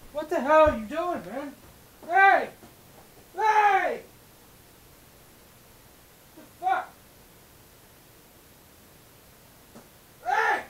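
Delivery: loud, high shouts to stop the cat; angry shouts at a cat clawing things; real yelling at the cat. Real, not acted. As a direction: yell hard to get the cat's attention. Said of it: "That was me just yelling".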